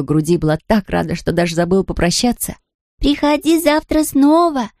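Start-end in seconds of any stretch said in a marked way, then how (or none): 3.0-4.7 calling after. Acted. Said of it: "крикнула ей вдогонку Лиси Лотта".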